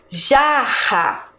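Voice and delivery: jarra slowly, in two parts, strong H-like RR; drawn-out jarra with a strong RR. Shown as a demonstration. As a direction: model jarra for pupils to repeat, forceful double R. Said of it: "repita aí com a professora".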